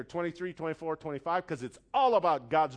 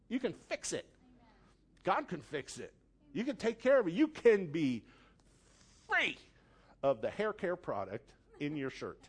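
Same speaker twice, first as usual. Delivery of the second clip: usual speaking voice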